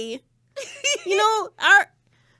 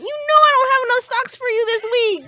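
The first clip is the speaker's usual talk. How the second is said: louder, exasperated rant half-laughing; 4 semitones higher, 6 dB louder than usual. Retold or imitated. Mom ranting at her kid eating socks, laughing. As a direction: vent at the kid, cracking up.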